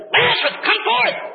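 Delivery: a loud, rising call to raise the dead; commanding shout. Acted. Preacher voicing Jesus calling Lazarus from the tomb, commanding shout. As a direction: cry it out high and loud with full authority.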